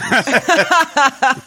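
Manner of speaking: short high burst of laughter. Real, not acted. Co-host tickled by the silly word, laughing.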